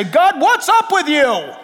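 drawn-out mock protest to God; complaining Joshua. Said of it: "Joshua is about to say".

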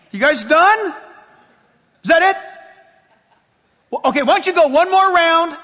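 sarcastic jeer at the prophets; mocking Elijah. Elijah taunting the pagan prophets, mocking. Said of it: "And he's like".

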